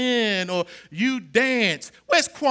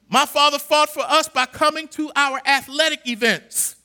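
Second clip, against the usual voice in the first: ordinary talk, nothing marked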